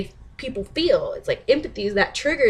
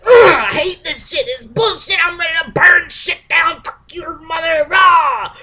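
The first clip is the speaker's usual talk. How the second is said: higher, much louder ranting shout; an angry person shouting, higher and louder; mock furious rant; 7 semitones higher, 8 dB louder than usual. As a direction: ham up a raging hothead ending in a roar.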